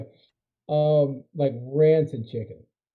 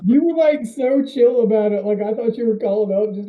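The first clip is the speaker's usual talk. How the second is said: excited teasing; 6 semitones higher, 5 dB louder than usual. Real, not acted. Teasing friend about call.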